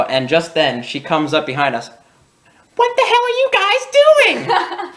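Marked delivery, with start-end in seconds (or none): 2.8-4.9 very high, slow mock-outraged mom shriek, laughing through it. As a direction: squeal it like a scandalized mom, cracking up. Mom catches kids, laughing.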